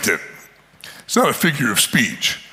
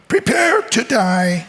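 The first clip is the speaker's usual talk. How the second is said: high, slow squeaky challenge; little kid threat; 7 semitones higher, slower than usual. Acted. David warns the giant in a squeaky kid voice. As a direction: squeak the threat like a small boy.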